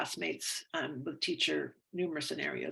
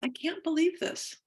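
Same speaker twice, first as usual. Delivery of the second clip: higher, rising cry of disbelief on believe; acted disbelief; 12 semitones higher, 5 dB louder than usual. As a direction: throw it up like you're stunned. Scripted disbelief while tapping.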